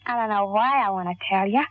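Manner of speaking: ordinary delivery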